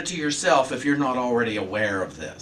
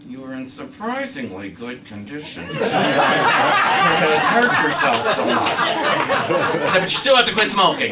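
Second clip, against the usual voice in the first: louder, higher, laughing through it; laughing while quoting; 3 semitones higher, 9 dB louder than usual. Retold or imitated. Doctor giving good results but scolding about smoking, laughing. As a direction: let the laughter spill into the doctor's words.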